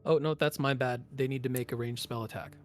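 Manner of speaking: normal talking voice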